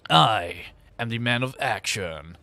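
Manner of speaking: deep-pitched, drawn-out tough-guy line; Duke Nukem. Acted. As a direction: attempt a macho video-game hero. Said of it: "it's more of a Duke Nukem voice".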